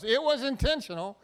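mock yell of a fallen dwarf; Gimli yelling. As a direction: bark it out like the dwarf falling off his horse. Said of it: "he yells".

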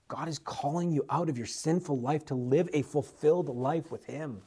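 soft, hushed appeal to come to Jesus; tender altar call. Acted. Preacher softly inviting the congregation to Jesus. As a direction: speak gently, as if to one person.

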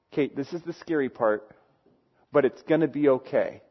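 put-on calm a nervous kid during the scary part; softly comforting, like at a movie; soothing a scared child. Parent soothing nervous kids at movie.

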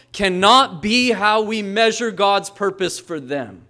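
the pastor building to a thundering point; full-force preaching. Acted. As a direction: preach it out with rising fire.

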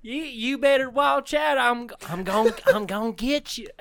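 high southern twang; playful stalking threat in a hammed-up southern accent; Phantom Bell man. Ghost bellman coming after a guest, in a high southern accent.

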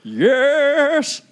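eager shout. Acted. Bachelor answers eagerly.

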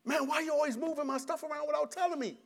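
high, mock-irritated complaint to his wife; bad way to complain. Shown as a demonstration. Husband griping at his wife about moved stuff, mock-irritated. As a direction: whine it at her like an annoyed husband. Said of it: "Here's a bad way to handle".

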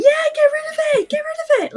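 very high, frantic retold pleading; mock-hysterical high squeal. Daughter begging dad to remove the doll, hysterical. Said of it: "I'm hysterical by this point".

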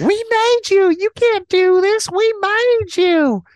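shrill very high desperate pleading; frantic hammed-up wail; hysterical waitress. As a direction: screech it like she's snapped. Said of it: "she's just like".

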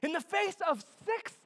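high, driving preacher's voice; fervent preaching. Real, not acted. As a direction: build forcefully to the big number. Preaching at full force.

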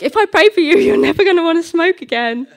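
high, loud, laughing declaration; overbold promise. Retold or imitated. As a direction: proclaim it with comic overconfidence. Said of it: "I get this boldness come over me".

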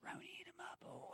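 very soft, whispered rapture; hushed savouring. Pretend. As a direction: whisper it like a sensual secret. Savouring a cookie sandwich description.

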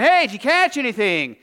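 hearty hail from afar; calling out. Acted. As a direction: yell it across the water from shore. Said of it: "He yells out".

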